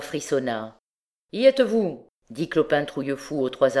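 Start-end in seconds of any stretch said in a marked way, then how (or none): 1.3-2.1 sharp call. A narrator's reading. Clopin ready to signal the hanging.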